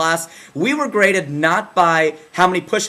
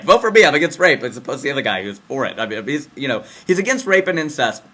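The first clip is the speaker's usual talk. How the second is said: ordinary talk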